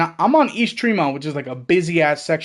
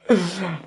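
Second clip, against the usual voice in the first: laughing; 3 dB quieter than usual. Real, not acted. Laughs at own story.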